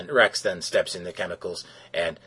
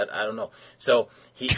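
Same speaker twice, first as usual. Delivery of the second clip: usual speaking voice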